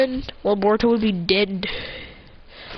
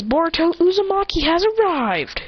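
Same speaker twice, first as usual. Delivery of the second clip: announcing an arrival; 7 semitones higher than usual. Retold or imitated. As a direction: proclaim it like a mock herald.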